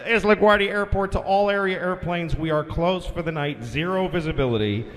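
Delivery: low, steady official radio announcement; lightly voiced controller; tower announcement. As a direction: read it like a flat airport notice. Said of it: "the LaGuardia guy comes".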